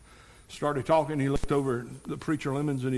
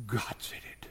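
soft lower whisper; 4 semitones lower, 7 dB quieter than usual. Acted. Preacher stressing God said it, whispered. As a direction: breathe it out as final word.

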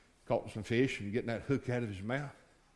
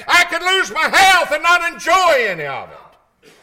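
high, loud shouted sermon; full-force preaching; 14 semitones higher, 15 dB louder than usual. Acted. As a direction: drive each phrase home at a preacher's full shout.

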